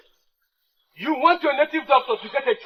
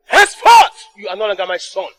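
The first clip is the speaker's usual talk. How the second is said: stern pronouncement; 3 semitones lower, 10 dB louder than usual. Acted. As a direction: proclaim it for all to hear. King disowns son.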